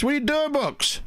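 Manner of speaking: lightly put-on Red asking on the phone; imitating Red on the phone. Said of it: "he rings me up and he goes".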